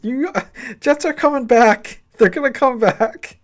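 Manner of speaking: Bill Burr's hyped Jets fan. Retold or imitated. Stepdad in the movie insisting the Jets are coming back, high laughing mock fan voice. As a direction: squeal the Jets comeback like a believer, cracking up. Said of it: "Bill Burr goes".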